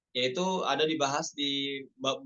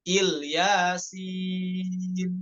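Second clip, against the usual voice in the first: very slow drawn-out chant; Quranic recitation; 3 semitones higher, much slower than usual. Shown as a demonstration. Teacher reciting Il Yasin slowly. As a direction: hold Il Yasin as one unit. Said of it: "Nggak boleh wakaf pada kata il".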